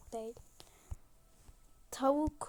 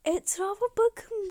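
excited; 8 semitones higher, 5 dB louder than usual. Real, not acted. Showing a cute game scene.

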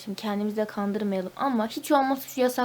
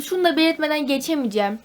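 ordinary talk, nothing marked

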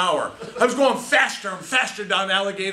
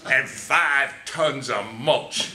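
slow punchline; 4 semitones lower, much slower than usual. Acted.

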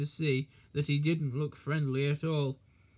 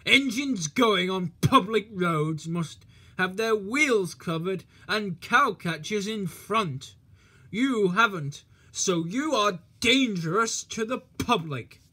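officious lecture; 4 semitones higher, 5 dB louder than usual. Acted. Policeman lectures Thomas on road rules, pompous and loud. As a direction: lay down the law pompously.

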